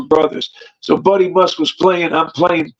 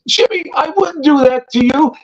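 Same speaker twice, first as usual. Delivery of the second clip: higher, pleading voice of the beaten man; 7 semitones higher than usual. Retold or imitated. Beaten man insisting to Jimmy, pleading higher. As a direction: beg him to believe you.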